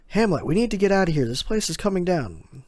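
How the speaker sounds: high, loud, fast urgent call. Retold or imitated. Usopp urges Hamlet to escape, loud and fast. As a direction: rush the order out.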